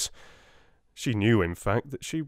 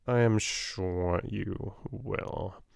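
slow and sly, a sinister smile in the voice; much slower than usual. Acted. Solicitor mocks the headmistress with a sinister smile. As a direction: let the menace creep in slowly. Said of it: "with a little sinister smile".

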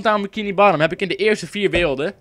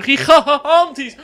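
laughing exaggeration; 10 semitones higher, 6 dB louder, much slower than usual. Real, not acted. Exaggerated plea for likes, laughing.

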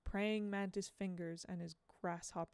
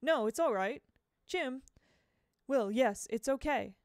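louder, urgent protest; Jim's raised plea; cried out; 5 semitones higher, 6 dB louder than usual. Acted. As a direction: hurry to stop the crowd. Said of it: "Jim cried".

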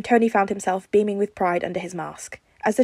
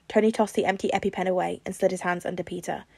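ordinary delivery, nothing marked

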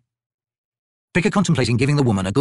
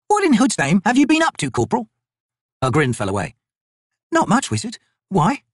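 higher, brisk soldier banter; female soldier voices; 10 semitones higher than usual. Acted. Two soldiers bickering over cursed armbands, higher and brisk. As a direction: rib your fellow soldier. Wizard confronts corporal.

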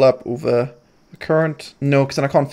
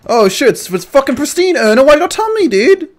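high, loud, overjoyed; excited; 12 semitones higher, 6 dB louder than usual. Real, not acted. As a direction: squeal about the great find. Thrilled by a pristine loot find.

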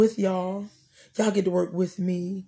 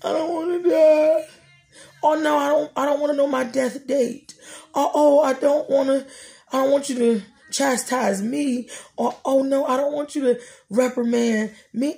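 mock panic; 5 semitones higher than usual. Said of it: "And I'm like".

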